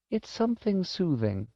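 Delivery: put-on high, tender old-lady voice; elderly woman's high, kindly voice; gentle old woman. A narrator's reading. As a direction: explain it gently to a child, pitched high. Old woman explains solace.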